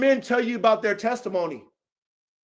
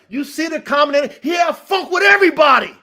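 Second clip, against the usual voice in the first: loud, raised-pitch incredulous shout; real exasperation, building to a shout; exasperated outburst; 5 semitones higher, 6 dB louder, faster than usual. Real, not acted. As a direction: shout it out, hitting EVERYBODY hardest. Exasperated at someone feuding with all.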